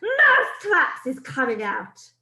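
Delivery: loud triumphant witch shout; loud gloating witch accent. Acted. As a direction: announce it to the crowd. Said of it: "raised her voice louder than ever and shouted".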